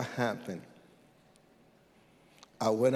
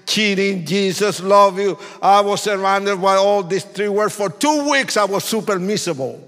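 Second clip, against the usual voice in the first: higher, louder preaching over music; preaching at full force; 6 semitones higher, 7 dB louder than usual. Acted. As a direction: hammer each line of the testimony.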